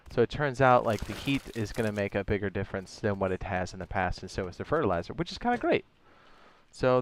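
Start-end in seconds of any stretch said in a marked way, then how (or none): 5.1-5.8 squeaky. Real, not acted. Squeaky aside.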